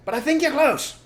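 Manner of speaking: high, loud farmer voice; loud high farmer reply; farmer Bogus. Acted. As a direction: answer gruffly. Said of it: "said Bogus".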